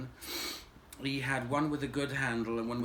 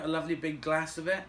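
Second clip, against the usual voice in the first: normal talking voice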